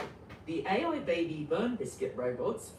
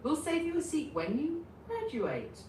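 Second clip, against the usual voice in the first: cheerful friend voice; 10 semitones higher than usual. Acted. Cheerful classmate voiced in story.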